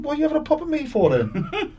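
high, laughing retort; exasperated question broken by laughter; laughing exasperation. Retold or imitated. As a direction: laugh at the absurdity while asking. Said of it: "I went".

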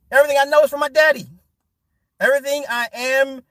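high, loud and fast; passionate. Real, not acted. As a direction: burst out with pride. Passionate praise of father.

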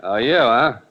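deep, menacing gangster voice; low and threatening; tough guy. Acted. Gangster voice continued.

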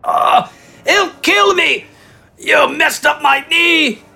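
very high, pained wail; injured, panicked. A narrator's reading. Bandit with a popped kneecap, wailing in fear. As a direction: cry out high in panic and pain. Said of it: "the injured man responded".

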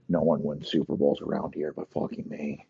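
normal talking voice

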